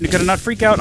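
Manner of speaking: ordinary talk, nothing marked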